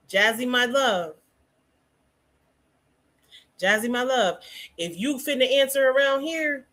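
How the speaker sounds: normal talking voice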